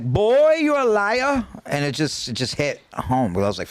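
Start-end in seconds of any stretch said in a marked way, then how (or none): 0.0-1.5 much higher Jamaican nurse voice, the first word punched; Jamaican accent. Retold or imitated. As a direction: call him a liar in the Jamaican nurse's accent. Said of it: "let me try to do my Jamaican accent".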